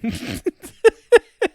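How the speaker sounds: high, loud burst of laughter. Real, not acted. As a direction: howl with laughter at the punchline. Loud laugh after the rant.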